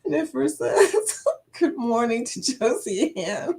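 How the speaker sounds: reading through laughter, slow; laughing. Real, not acted. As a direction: laugh helplessly while reading it out. Laughing reading chat comment.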